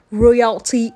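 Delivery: ordinary delivery, nothing marked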